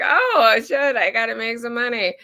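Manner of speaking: surprised. Retold or imitated. Sudden realisation, acted inner voice.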